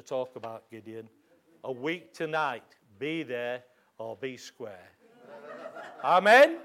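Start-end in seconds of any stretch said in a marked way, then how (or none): 6.0-6.6 louder and higher, a ringing Amen with a rise; rousing call. Real, not acted. Preacher rousing the congregation to answer back, loud and rising.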